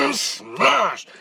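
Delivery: high strained effort noise into "smash"; high-pitched impact roar, acted out. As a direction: imitate a violent slam with your voice. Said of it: "I mean, I go".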